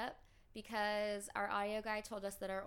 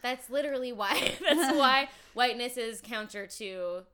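laughing; 2 semitones higher, 13 dB louder than usual. Real, not acted. Laughs while ranting.

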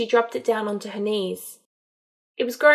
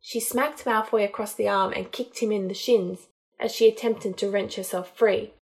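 ordinary delivery

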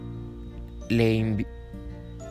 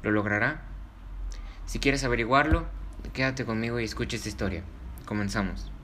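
put-on cheerful host welcome for kids; kids show host; 3 semitones higher than usual. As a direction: greet the children, bright and friendly. Host welcomes children to story.

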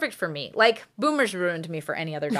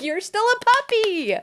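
put-on much higher cooing baby talk; baby talk to dog; 12 semitones higher than usual. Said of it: "and I'm like".

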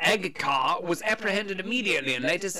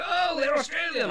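mock American listener; 9 semitones higher than usual. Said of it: "they go".

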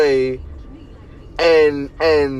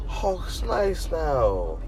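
lightly mocking a tame fan voice; a hint of a soft-spoken people-pleaser; 3 semitones lower, 6 dB quieter than usual. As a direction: gently parody the tame crowd. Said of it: "They go".

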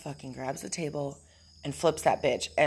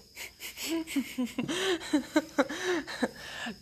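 long real laugh; 11 semitones higher, 4 dB quieter than usual. Real, not acted. Laughs at own remark.

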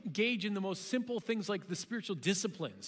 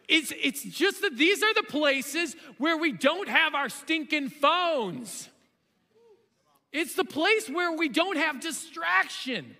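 loud, slow, high and driven; full-force preaching about phones; preaching shout; 8 semitones higher, 7 dB louder, slower than usual. Real, not acted. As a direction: hammer the point home to the congregation. Preacher driving home point.

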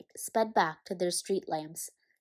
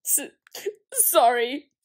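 stammered, louder, higher sob; sobbing; 6 semitones higher, 7 dB louder, slower than usual. Acted. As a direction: choke the word out through tears. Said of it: "sobbed Hagrid".

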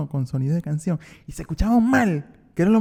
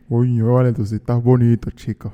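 lower, half-laughing seductive voice; a hint of a smooth flirt; 4 semitones lower than usual. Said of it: "Lanza tu voz".

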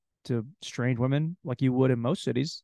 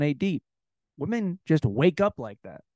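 ordinary talk

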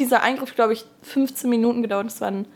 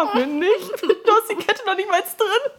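mock retort; 11 semitones higher than usual. Retort to doctor retold.

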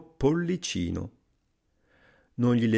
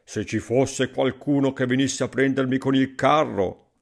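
usual speaking voice

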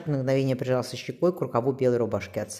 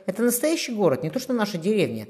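higher, mildly dismissive boast; scornful; 7 semitones higher than usual. A narrator's reading. Youth comparing city with village, scornful. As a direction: scoff lightly, pitch lifted. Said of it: "он презрительно фыркнул".

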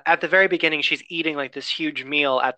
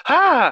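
startled shriek; 12 semitones higher, 7 dB louder than usual. Retold or imitated. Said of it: "and she goes".

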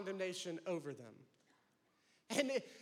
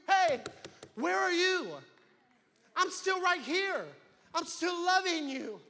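higher, louder pleading call; God calling out; 4 semitones higher, 4 dB louder than usual. Acted. Preacher voicing God calling to those who ran away. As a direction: call them home with longing.

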